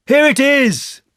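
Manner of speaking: very high, loud outburst; excited cry. A narrator's reading. Christopher Robin finding Pooh's present, excited cry. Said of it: "cried Christopher Robin excitedly".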